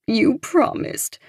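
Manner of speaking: high, slow tearful plea; sobbing. A narrator's reading. Evie accusing Claire while crying, high slow sob. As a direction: break into sobs on it. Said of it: "Evie starts to sob".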